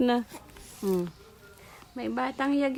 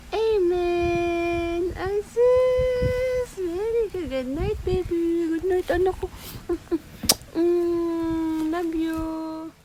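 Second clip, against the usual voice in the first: teary goodnight; 7 semitones higher, 5 dB louder, slower than usual. Real, not acted. Tearful goodnight after the prayer.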